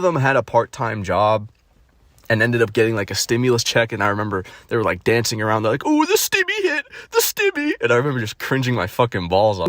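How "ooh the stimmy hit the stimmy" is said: very high, giddy mimicking voice; mock celebration. Mocking them dancing over a stimulus check, high giddy voice. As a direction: squeal it like a silly celebration.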